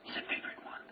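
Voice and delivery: very soft, voiceless whisper; barely audible whispered aside; hushed confiding whisper. Real, not acted. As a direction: whisper it like an exam secret. Confiding an exam favorite.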